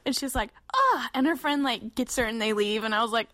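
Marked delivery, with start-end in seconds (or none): 0.7-1.1 high-pitched "ugh" of disgust; sharp, squealed ugh. Retold or imitated. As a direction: imitate a grossed-out stranger. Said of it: "disgusted, she's horrified".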